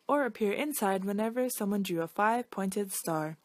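plain reading voice

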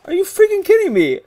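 loud, high-pitched gamer outrage; outraged disbelief. Real, not acted. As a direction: cry out high at an unfair death. Outraged at dying in the game.

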